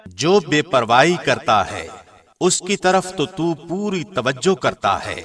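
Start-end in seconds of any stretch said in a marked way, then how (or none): none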